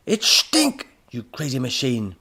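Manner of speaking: very high, loud burst that drops on the insult; angry outburst. A narrator's reading. Professor correcting robot on his name, angry outburst. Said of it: "the professor growled".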